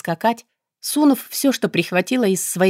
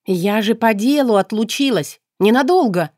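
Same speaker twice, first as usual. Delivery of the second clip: plaintive; 5 semitones higher, 4 dB louder than usual. A narrator's reading. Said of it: "добавила жалобно".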